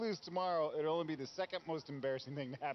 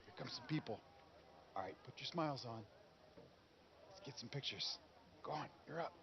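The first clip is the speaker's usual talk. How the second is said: soft, lowered voice after an outburst; soft and deflated; subdued instructions; 3 semitones lower, 9 dB quieter than usual. Acted. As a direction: give orders quietly, chastened.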